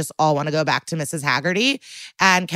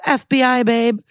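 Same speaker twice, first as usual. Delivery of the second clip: higher-pitched, showy introduction; mock cocky; 5 semitones higher, 4 dB louder than usual. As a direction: play the cocky agent announcing himself. Acting out agent's cocky introduction.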